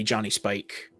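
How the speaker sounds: usual speaking voice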